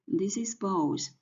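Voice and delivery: ordinary delivery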